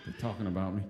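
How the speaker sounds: very low, hushed inner voice. Retold or imitated. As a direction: mutter it low and small, as if hidden.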